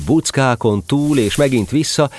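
usual speaking voice

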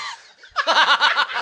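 high, loud burst of laughter; loud cackling laugh. Real, not acted. Storyteller losing it over pissing on Dolph, laughing. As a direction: explode laughing.

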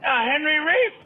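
high, loud mock masseuse; masseuse's question. Masseuse pressing the baffled client, high mock voice. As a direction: lift it high and ask insistently. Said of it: "She says".

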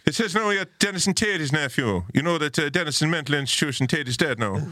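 high singsong Irish woman; Irish landlady accent. Retold or imitated. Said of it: "and she says".

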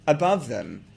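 ordinary talk, nothing marked

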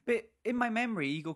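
ordinary delivery

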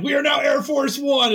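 big heroic shout, high; dramatic movie shout. Retold or imitated. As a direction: belt it like an applause line. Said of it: "They're like".

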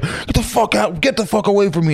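high, sing-song angry husband; imitating the husband shoving him off; mock husband yell.

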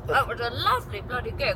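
chewing while talking; words around a chewy sweet; mouth full. Real, not acted. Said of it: "Sorry, I'm eating a fizzy pigtail".

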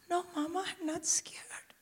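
put-on high, hushed little-girl whisper; little daughter. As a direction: play a tearful child whispering back to mum. Voices sobbing daughter in cinema.

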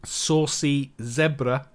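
very slow, every sound crisp; slow and clear. Shown as a demonstration. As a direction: show off the new mic slowly. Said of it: "I could just say really funky words like".

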